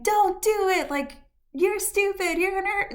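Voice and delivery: high, mocking kid voice calling up; kids heckling. Kids in the backyard jeering at her, high mocking call. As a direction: jeer up at the balcony like a scornful child.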